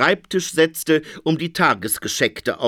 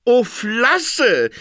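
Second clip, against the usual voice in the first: high, bright burst of joy; delighted exclamation; 9 semitones higher, 4 dB louder than usual. A narrator's reading. Phoenix spots the flea market, excited cry. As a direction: cry out while clapping hands. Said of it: "riek der Phönix und flatschte in die Hände".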